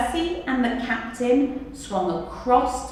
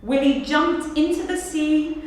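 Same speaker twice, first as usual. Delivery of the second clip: usual speaking voice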